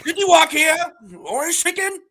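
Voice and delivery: very high cartoon shopkeeper voice; City Walk guy. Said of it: "the City Walk guy from South Park".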